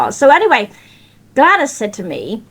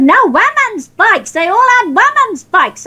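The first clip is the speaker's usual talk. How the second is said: shouting; 9 semitones higher than usual. Retold or imitated. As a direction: shout it, getting fed up. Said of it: "by this time he was shouting his head off".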